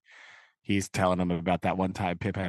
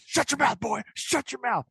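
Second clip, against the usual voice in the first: high, strained mock threat; mock fierce order; 13 semitones higher than usual. Host plays Magwitch grabbing Pip's face, mock fierce order. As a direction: act out a sudden attack. De Niro grabs Pip, acted threat.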